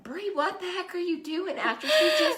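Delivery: can't believe what she's hearing; shocked disbelief. Retold or imitated. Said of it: "I'm like".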